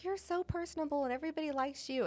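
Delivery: high-pitched mock compliment; imitated admirers. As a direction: gush it lightly up high. Quoting admirers of her.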